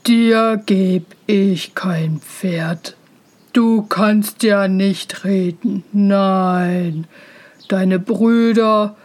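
slow, heavy father voice; stern father. A narrator's reading. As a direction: refuse him slowly and weightily. Narrator voices stern father refusing.

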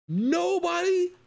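high, stretched disbelieving nobody; stunned it goes unnoticed; shocked. Real, not acted. Shocked at stat neglect.

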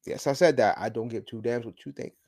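usual speaking voice

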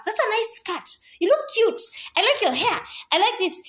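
high, bright hype-friend voice; gushing compliments. Retold or imitated. Hyping up a friend's outfit, gushing.

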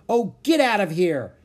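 high, loud shooing shout; indignant shout. A narrator's reading. Annie to the circling vulture, indignant shout. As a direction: shout to drive off a pest. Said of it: "Annie shouted at the vulture".

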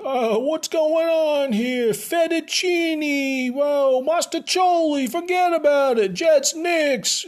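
high, drawn-out caricature of a New Yorker; cartoon New York accent, every word stretched; exaggerated New York accent. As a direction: ham up a loud, slow New York accent. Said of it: "a New York accent".

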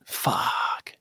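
under the breath, unvoiced swear; whispered curse. Real, not acted. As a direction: whisper it to yourself. Stumped by a hard question.

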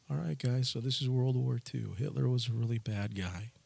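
quiet, sleepy teacher drone; boring history teacher. Retold or imitated. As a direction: lull the class like a dull teacher after lunch. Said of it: "and he talked like this".

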